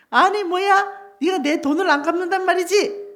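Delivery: high, loud mock outrage; indignant anger. Creditor refuses his begging friend, indignant anger. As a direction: confront him with acted indignation. Acted creditor rejecting the plea.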